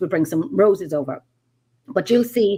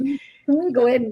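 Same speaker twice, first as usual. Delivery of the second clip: put-on Irish accent; 5 semitones higher than usual. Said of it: "the big Irish accent on me".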